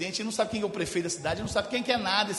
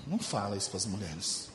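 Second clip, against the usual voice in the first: low quiet aside; 12 semitones lower, 9 dB quieter than usual. Acted. Aside to the doctor.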